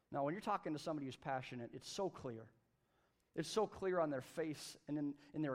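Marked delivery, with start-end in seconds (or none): none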